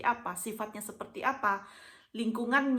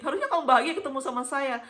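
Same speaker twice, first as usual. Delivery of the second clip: higher, louder quote with a laugh in it; amused protest; 4 semitones higher, 6 dB louder than usual. Retold or imitated. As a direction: imitate the husband protesting, laughing along. Husband's quote, told through laughter. (tiruan suami protes sambil tertawa)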